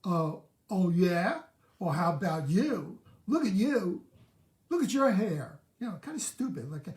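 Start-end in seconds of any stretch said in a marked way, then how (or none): 0.0-5.6 put-on loud, higher, childish comeback; mock counterattack. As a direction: snap back like a stung kid. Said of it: "Do you counterattack?".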